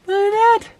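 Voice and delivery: delighted at the boiling pot; excited squeal. Real, not acted. Water boiling on the stove.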